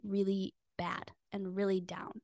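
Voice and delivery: dropping to a whisper; a sad, hushed whisper. Real, not acted. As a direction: breathe the words out with no voice. Describing feeling down that morning.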